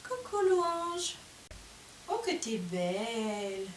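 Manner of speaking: slow cooing hello, then soft praise. Real, not acted. Greeting her new filly tenderly.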